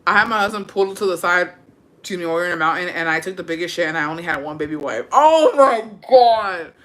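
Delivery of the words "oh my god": slow, loud, stretched-out shocked exclamation; drawn-out oh my God. Real, not acted. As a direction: gasp it out slowly in horror. Reacting to a gross story.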